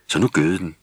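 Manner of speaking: ordinary delivery, nothing marked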